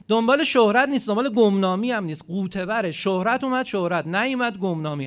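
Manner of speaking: loud, emphatic sermon voice; preaching at full force. Acted. Preacher to congregation, loud and emphatic. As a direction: drive the point home to the congregation.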